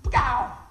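sudden loud "pow" burst; explosive imitated impact noise; loud exclaimed pow. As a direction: punch it out like a bang. Imitates the jolt of recognition.

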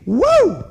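high, loud "woo"; excited whoop. Acted. Pastor picturing the Lord's light, excited whoop. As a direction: let out a jubilant whoop.

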